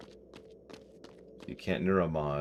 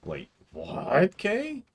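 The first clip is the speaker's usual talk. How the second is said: exaggerated high questioning rise; mock disbelief; 11 semitones higher, much slower than usual. Streamer pretends Kay blew their mind, mock disbelief. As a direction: pretend to be astounded, pitch leaping up. Feigns shock at Kay again.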